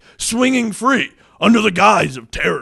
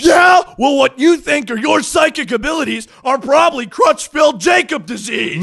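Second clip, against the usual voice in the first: louder, higher mocking retort; scornful shout; 8 semitones higher, 6 dB louder than usual. Host mocking the Mangler's psychic powers, loud. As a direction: shoot back with scorn.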